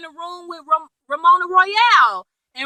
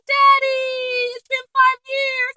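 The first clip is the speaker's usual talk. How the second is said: put-on much higher, small childlike plea; child's voice; 10 semitones higher, slower than usual. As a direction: sound like a little boy reaching for his dad. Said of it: "And Holden's like".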